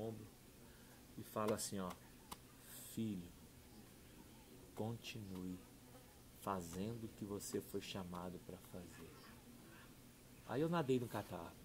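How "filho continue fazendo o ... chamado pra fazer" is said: gentle blessing. Retold or imitated. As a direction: lay a calm hand on him with the voice. Stranger comforts him in prayer.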